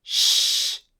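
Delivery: a long hushing sh with no vowel; a voiceless sh held without a break. Shown as a demonstration. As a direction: keep the し going as one steady hush. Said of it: "をずっと発音してください".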